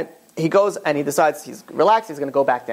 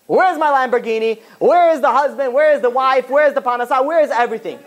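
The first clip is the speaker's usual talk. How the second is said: higher-pitched mock demand to God; insistent, high-pitched acted griping; demanding complaint; 7 semitones higher, 3 dB louder than usual. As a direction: pile up the questions like an entitled complainer. Acting out demands made to God.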